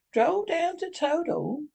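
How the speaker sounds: high, drawn-out incredulous question; greatly excited cry. A narrator's reading. Rat astonished at Toad's plan, greatly excited. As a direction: exclaim it high, stretching the words in disbelief. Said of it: "cried the rat, getting greatly excited".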